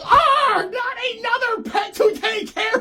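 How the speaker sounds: high, exasperated groan of a put-upon owner; exasperated John. Retold or imitated. John groans on finding Odie in his suitcase, high and fed up.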